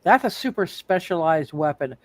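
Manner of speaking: ordinary delivery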